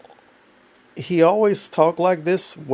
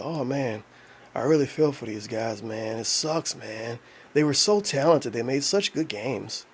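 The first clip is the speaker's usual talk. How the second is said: soft, lower-pitched put-on condolence; mock sympathy; 3 semitones lower, 6 dB quieter, slower than usual. Streamer imitating a critic when a company goes under, mock mournful. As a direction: imitate someone quietly lamenting a sad loss. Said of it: "And then a company going to".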